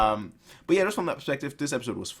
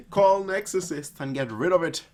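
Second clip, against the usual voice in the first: raised, higher playful alarm; pretend-desperate demand, pitched up; mock urgent plea; 7 semitones higher, 4 dB louder than usual. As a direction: call out in mock panic. Louder repeat of exorcist plea.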